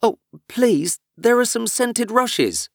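high, slowed delighted gasp of wonder; light up at the sight; delighted cry. Acted. Alice delighted at spotting scented rushes. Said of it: "cried in a sudden transport of delight".

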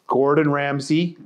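usual speaking voice

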